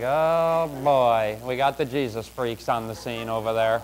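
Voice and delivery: low, quiet grumble; mock an onlooker's weary aside; low-pitched, hushed dismay; imitated disapproving onlookers. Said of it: "And they're like".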